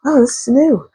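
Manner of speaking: high, meek little voice; small high voice of someone out of their depth; unsure newcomer. Acted. Voicing the unsure Star figure.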